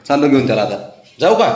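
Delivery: ordinary talk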